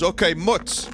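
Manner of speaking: deli guy impression. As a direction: imitate the deli guy confirming an order, pitch up. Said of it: "then again he goes".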